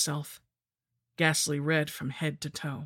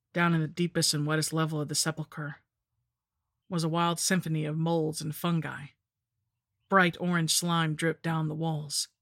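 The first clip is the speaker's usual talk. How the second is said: plain reading voice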